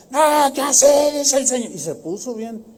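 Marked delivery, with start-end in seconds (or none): 0.1-1.7 mock aggressive woman. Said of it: "muy agresiva".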